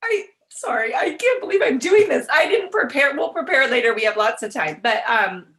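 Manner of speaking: choked up, fighting to keep it together; real emotion pushing the voice up and loud; emotional. Real, not acted. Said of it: "it is a little emotional for me".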